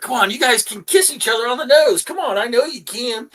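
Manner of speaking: high, sing-song coaxing of pets; coaxing pet owner. Acted. Game master plays someone urging dog and ferret together, coaxing. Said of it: "you kinda do the thing like".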